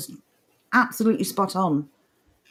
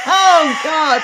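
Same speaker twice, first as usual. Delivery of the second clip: 1 laughing; 6 semitones higher, 5 dB louder than usual. Real, not acted. Laughing exclamation.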